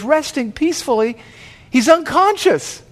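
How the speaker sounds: high incredulous echo rising to an outcry; appalled disbelief. Acted. Paramedic correcting the bystanders, appalled and incredulous.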